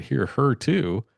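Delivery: retold disbelief, stretching "Hear her too?"; incredulous echo. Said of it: "And I said".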